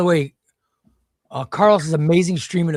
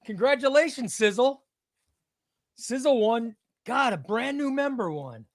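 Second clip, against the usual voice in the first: excited; 8 semitones higher than usual. Real, not acted. Announcing a new member won.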